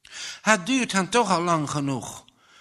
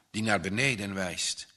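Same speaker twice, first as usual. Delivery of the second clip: ordinary delivery, nothing marked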